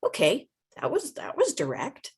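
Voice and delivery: high, startled ooh; taken-aback reaction. Acted. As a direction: recoil a little in mock surprise. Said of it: "we're like".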